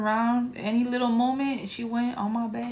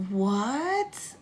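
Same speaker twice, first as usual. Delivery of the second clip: ordinary talk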